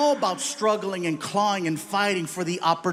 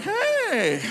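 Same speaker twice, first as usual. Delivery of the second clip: high, bright mock hello; cheery greeting; 13 semitones higher than usual. Red Lobster manager greeting the dad, high cheery hey.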